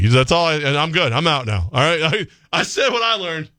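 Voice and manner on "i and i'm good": normal talking voice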